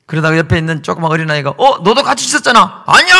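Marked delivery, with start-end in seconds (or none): 1.5-2.8 put-on accusing child. 2.8-3.2 denying shout. Acted. Peter denying.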